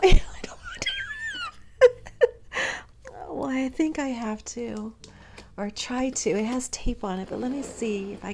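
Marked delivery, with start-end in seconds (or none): 0.0-2.8 very high, breathless laugh-cry; half sobbing, half laughing; laughing through tears. Real, not acted. Laughing and crying over gift.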